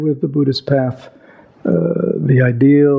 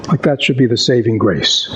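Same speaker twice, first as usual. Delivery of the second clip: ordinary talk, nothing marked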